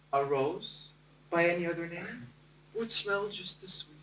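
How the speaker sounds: softly offered line of verse; hesitant quoting. Retold or imitated. Shy man quoting Juliet to his future wife, hesitant. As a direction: recite it softly, as a tentative dodge.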